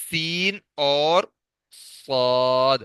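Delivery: سین crisp and firm, صاد heavier; letter demo. Shown as a demonstration. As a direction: sound out سین then صاد slowly. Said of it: "سین کو قدر سختی سے پڑھیں گے اور صاد کو نرمی سے".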